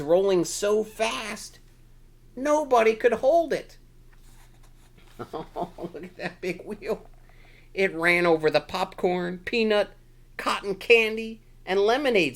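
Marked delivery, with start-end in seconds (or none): none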